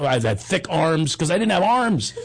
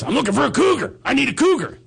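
higher, urgent coach call; 7 semitones higher than usual. Retold or imitated. Coach calls out for a player.

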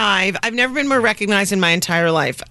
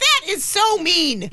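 mock hurt; 8 semitones higher, much slower than usual. Outraged retort to the guard.

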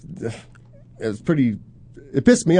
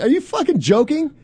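incredulous retelling; 12 semitones higher than usual. Retold or imitated. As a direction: pitch jumps high on an outraged question. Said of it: "I'm like".

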